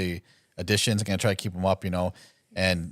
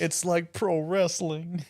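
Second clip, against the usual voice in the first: slow announcer voice; 8 semitones higher, 4 dB quieter, much slower than usual. Pretend.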